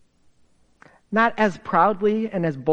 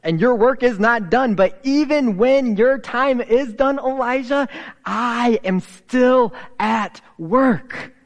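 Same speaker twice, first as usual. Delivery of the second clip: loud, raised preaching voice, slowing at the end; rousing charge; 5 semitones higher, 4 dB louder than usual. Retold or imitated. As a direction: build to a forceful declaration. God's rallying charge to Elijah.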